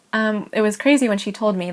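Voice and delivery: normal talking voice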